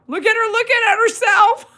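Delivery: high, loud squeal of amusement; hysterically amused. Real, not acted. Owner pointing out the dog's reflection, cracking up. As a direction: shriek it, tipping over into laughter.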